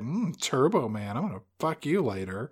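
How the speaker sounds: soft, hushed mock seduction; turned on. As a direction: purr it quietly, imitating an aroused wife. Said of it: "she's kinda turned on".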